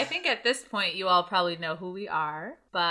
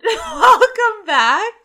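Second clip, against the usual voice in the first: laughing; 13 semitones higher, 11 dB louder, much slower than usual. Real, not acted. Laughing through a greeting.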